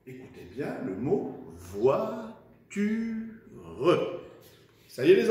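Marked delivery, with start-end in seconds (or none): 1.6-3.1 "voiture" drawn out slowly in three parts; syllable by syllable. Shown as a demonstration. Teacher dictating to pupils, "voiture" slowly syllable by syllable. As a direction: stretch "voiture" into voi, tu, re with a sounded final E. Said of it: "fait des petites syllabes".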